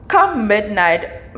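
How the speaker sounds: normal talking voice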